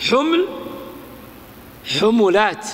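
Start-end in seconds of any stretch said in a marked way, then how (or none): 2.0-2.7 حملات said ḥumulāt, u on both ḥ and m; second vowel follows first. Shown as a demonstration. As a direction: say حملات with a short u after the m. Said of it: "تتبع حركة الثاني حركة الأول". (حملات بضم الحاء والميم)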